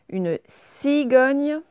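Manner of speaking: teacher sounding out CIGOGNE for pupils; clearly dictated word. Shown as a demonstration. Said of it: "je vous dis les mots".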